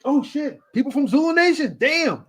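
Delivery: high, startled mock surprise; shocked exclamation. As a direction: pitch it up like you just saw stars walk in. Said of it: "I'm like".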